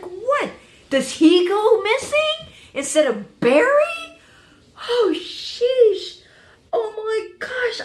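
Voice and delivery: high, incredulous outburst; shocked disbelief. Real, not acted. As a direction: cry out in alarm, drawing it out. Reacts to possible loss of Oliver.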